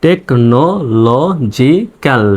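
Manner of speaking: Technological very slowly, syllable by syllable; broken into syllables. Shown as a demonstration. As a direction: break technological into separate, drawn-out pieces. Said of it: "ভেঙে ভেঙে এভাবে উচ্চারণ করলে".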